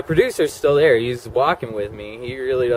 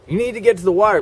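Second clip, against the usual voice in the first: higher and louder, insistent; urgent inner drive; 7 semitones higher, 5 dB louder, much faster than usual. Retold or imitated. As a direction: push the words out like an obsession. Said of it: "So it was like".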